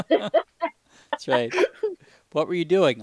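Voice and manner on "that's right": laughing. Real, not acted. Laughing at masturbation joke.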